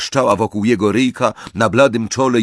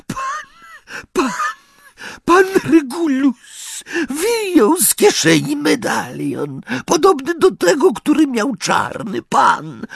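very high, tearful elf voice; sobbing house-elf. A narrator's reading. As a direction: weep the words out in a squeaky elf voice. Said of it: "a strumienie łez pociekły mu".

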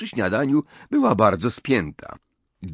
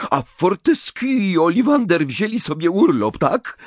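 higher, sharp and indignant; bristling retort; 10 semitones higher, 4 dB louder than usual. A narrator's reading. Mother flaring up at her son's flippant remark, indignant. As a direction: snap back, offended. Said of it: "zaperzyła się pani Wesley".

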